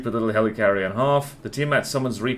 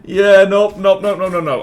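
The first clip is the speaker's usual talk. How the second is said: emphatic nope; 6 semitones higher, 9 dB louder than usual. Real, not acted. Emphatic refusal, loud.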